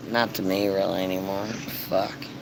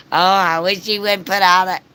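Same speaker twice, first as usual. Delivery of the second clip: mocking the neighbor; 7 semitones higher, 5 dB louder than usual. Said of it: "she's bitching about".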